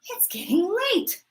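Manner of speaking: whispered impatient thought. Acted. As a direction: mutter it under your breath.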